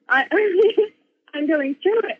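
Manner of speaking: ordinary talk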